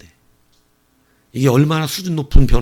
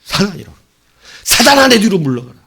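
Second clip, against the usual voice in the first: higher, louder commanding rebuke; Jesus rebuking Peter, higher and louder; stern rebuke; 6 semitones higher, 6 dB louder than usual. Acted. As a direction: drive the tempter back with a sharp command.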